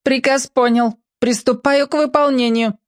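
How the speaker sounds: high, even-pitched robot voice; clipped film robot reporting for duty. Acted. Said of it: "сказала Лика голосом робота из фильма".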